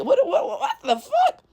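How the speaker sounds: high, laughing "what the fuck"; laughing disbelief. Real, not acted. Baffled by unteachable people, laughing high.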